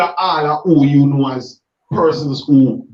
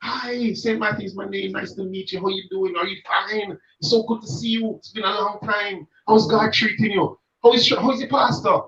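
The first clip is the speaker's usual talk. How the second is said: higher and much softer, mock-saccharine pleasantries; hypocrite style greeting; 4 semitones higher, 4 dB quieter than usual. Mocking the hypocrite greeting he is asked to give, higher and softer. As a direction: play the overly nice hypocrite at church. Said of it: "I want hypocrite style".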